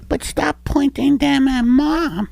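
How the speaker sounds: put-on high little boy voice; kid voice. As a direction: play a sweet little boy. Child voice.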